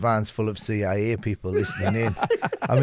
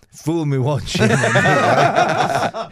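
laughing; 3 semitones higher, 6 dB louder, much slower than usual. Real, not acted. Quoting proverb while laughing.

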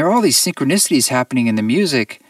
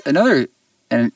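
ordinary talk, nothing marked

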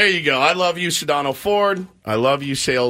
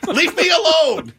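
high-pitched mock exasperation; whiny mock refusal breaking into laughter; exasperated outburst; 12 semitones higher than usual. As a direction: retell it as a comic fed-up protest, voice pitched way up. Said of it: "And I'm like".

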